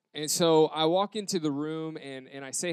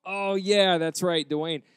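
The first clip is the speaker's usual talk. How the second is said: loud, higher burst of pretended remembering; hammed-up relief at a rescued name; fake recognition; 4 semitones higher, 7 dB louder, slower than usual. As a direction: bluff that you knew it all along. Faking that he remembered.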